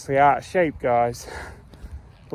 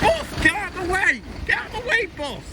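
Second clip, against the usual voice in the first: shout; faster than usual. Real, not acted. Yelling at someone blocking the path, very high shout. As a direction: bellow it so they move at once. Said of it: "hear me shout it".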